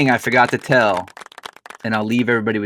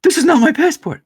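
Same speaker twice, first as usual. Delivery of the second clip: higher and louder, near shrieking; wife's panic peaking, high and loud; rising panic; 5 dB louder than usual. Retold or imitated. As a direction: repeat it more frantic, panic building. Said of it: "all of a sudden she's like".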